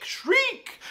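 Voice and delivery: the word shriek leaps way up high; a high, piercing rise on shriek. Acted. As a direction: make shriek itself shriek. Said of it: "they can only shriek".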